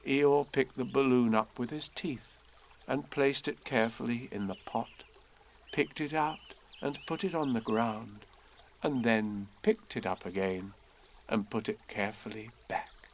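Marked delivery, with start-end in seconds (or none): none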